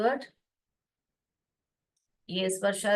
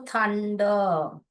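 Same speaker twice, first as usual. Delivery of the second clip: slow modelling. Shown as a demonstration. Teacher slowly modelling thunder to the students. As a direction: thunder stretched out, very slow and careful. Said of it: "teacher models the word slowly".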